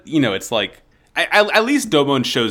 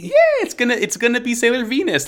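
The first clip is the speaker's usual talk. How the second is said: high mock fangirl voice; 10 semitones higher, faster than usual. Fan voice, high pitch.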